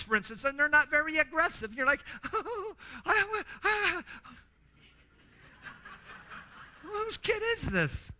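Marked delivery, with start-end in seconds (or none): 2.3-8.1 high, slow, aghast mock disbelief; dismayed parent. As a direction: act the horrified sports dad. Disappointed parent watching timid child.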